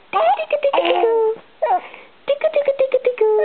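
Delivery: very high squeaky tickle chant; giggly tickle attack. Acted. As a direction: squeal it while tickling a baby. Mum tickles baby.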